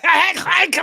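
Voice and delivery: sudden high shouted rant; a brief hammed-up screaming tyrant; mock Hitler yell. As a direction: bark it like a raging dictator. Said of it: "when I think of Hitler".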